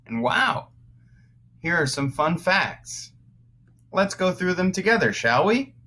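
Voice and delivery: bright, high, eager teacher voice; excited. Acted. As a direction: sell the fun facts to the kids, fast at the end. Teacher hyping fun facts for kids.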